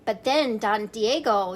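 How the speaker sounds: ordinary delivery, nothing marked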